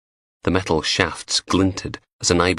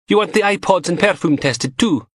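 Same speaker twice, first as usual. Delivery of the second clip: higher teasing female voice; young woman voice; 12 semitones higher than usual. A narrator's reading. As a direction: play the cheeky lab tech, higher. Narrator voices Samantha.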